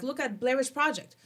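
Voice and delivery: normal talking voice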